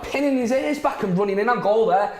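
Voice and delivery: high-pitched fan complaint; exasperated. Real, not acted. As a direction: berate the player from the sofa. Exasperated fan berating a player.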